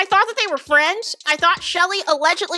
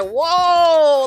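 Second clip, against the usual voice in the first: mock whoa; 4 dB louder than usual. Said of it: "He is like".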